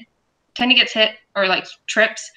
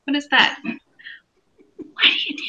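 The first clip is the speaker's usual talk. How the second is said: baffled squeak; 8 semitones higher, faster than usual. Real, not acted.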